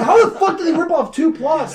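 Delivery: high, incredulous outburst with laughter; hammed-up outrage, voice pitched right up; exasperated disbelief. Fan outraged at stolen plots, exasperated disbelief. Retelling own outraged reaction.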